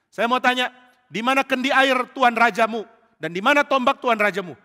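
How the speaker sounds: shouted, pointed questioning; loud challenge. Acted. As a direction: call the questions across a distance. Daud bertanya lantang dari seberang.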